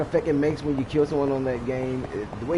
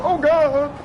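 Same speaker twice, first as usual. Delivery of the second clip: high, loud hammed-up cry of pain; mock enemy scream; 13 semitones higher, 7 dB louder than usual. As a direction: yelp it like a beaten game enemy. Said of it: "fall down and go".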